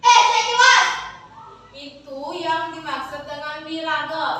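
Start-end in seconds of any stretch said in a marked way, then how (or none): 0.0-0.9 furious high shout; angry. Shown as a demonstration. Pupil acts out Men Sugih for the class, angry. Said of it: "dengan ekspresi marah dan menunjuk".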